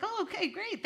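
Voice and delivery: mock startled brightness; surprised reply. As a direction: squeak it out, caught off guard. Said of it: "And I was like".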